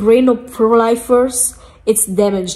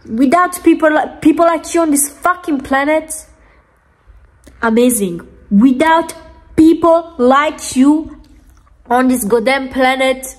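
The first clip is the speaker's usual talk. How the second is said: scornful anger; 3 semitones higher than usual. Real, not acted. Vlogger denouncing pro-lifers, scornful and angry. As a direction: hammer it out, pitched up and indignant.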